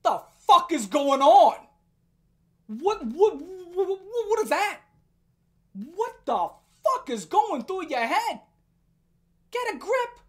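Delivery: loud, disbelieving yell; incredulous shout. Real, not acted. Ranting at the creator in loud disbelief.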